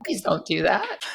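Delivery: mock plea. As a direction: plead in playful dismay. Coach pleads against using ChatGPT.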